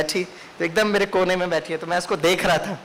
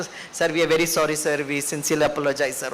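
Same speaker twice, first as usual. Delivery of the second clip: gentle apology; 6 semitones lower, much slower than usual. Retold or imitated. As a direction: soothe an angry passenger sweetly. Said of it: "वो बहुत प्यार से".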